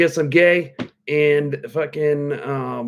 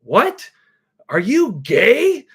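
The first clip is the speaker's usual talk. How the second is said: higher, louder, slow disbelieving mock teen; incredulous nephew; 7 semitones higher, 6 dB louder, much slower than usual. Thirteen-year-old nephew reacts to uncle's order, mock-incredulous. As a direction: stretch out the disbelief.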